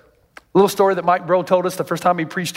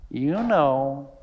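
softer, lower, sly parrot; 4 semitones lower, 5 dB quieter than usual. Acted. Parrot to threatened woman, sly understated taunt. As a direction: deliver the punchline quietly as the parrot. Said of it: "the parrot says".

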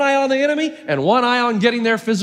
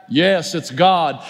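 lower, slow, solemn reply; voice of God; 6 semitones lower, much slower than usual. Acted. God answering the man on the cliff, lower and slow. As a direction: answer grandly from above.

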